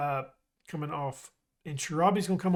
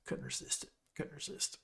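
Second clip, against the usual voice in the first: sheepish whisper; 13 dB quieter than usual. Real, not acted. Sheepish after making the pun.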